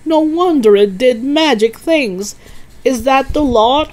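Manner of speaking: loud, high, impressed father; lively amazed questioning; excited cry. A narrator's reading. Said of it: "he cried".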